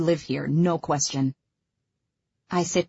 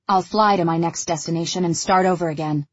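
ordinary delivery, nothing marked

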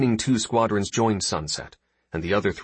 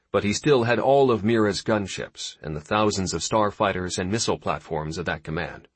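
ordinary delivery, nothing marked

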